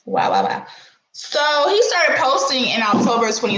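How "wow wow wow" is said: deep wow. Real, not acted. Reacting to Drewski's age.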